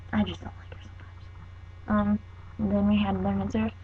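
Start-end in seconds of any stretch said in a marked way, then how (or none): 0.1-0.9 a hushed confession; whisper. Real, not acted. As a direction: whisper it like a secret. Confiding dislike of student teacher.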